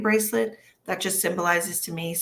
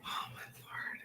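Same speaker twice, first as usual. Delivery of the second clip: breathy unvoiced whisper; 20 dB quieter than usual. Real, not acted. Medium whispering in amazement at the message. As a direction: whisper it in awe.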